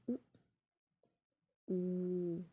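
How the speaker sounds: उ and ऊ said alone with lips rounded, ऊ held longer; उ, ऊ modelled for students; vowel demo. Shown as a demonstration. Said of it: "आप बोले ना छोटा उ".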